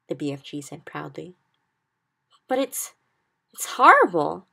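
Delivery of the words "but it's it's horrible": high, loud horrified outburst; horrified cry. A narrator's reading. Sophie cries out in horror at the nightmare, loud. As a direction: recoil from the thing in the jar. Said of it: "Sophie cried".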